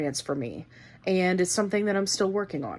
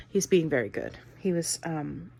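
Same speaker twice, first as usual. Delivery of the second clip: normal talking voice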